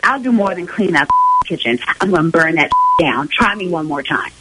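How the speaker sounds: low, menacing warning; real threat. Real, not acted. Girlfriend answering boyfriend's plea to clean, threatening. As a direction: warn him off with pitch dropped low.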